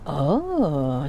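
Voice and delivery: normal talking voice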